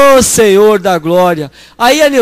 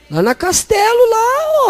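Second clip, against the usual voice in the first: much higher, boasting; proud excited; 12 semitones higher, slower than usual. Retold or imitated. Preacher proudly naming the sister's college, high. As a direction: lift it up proudly.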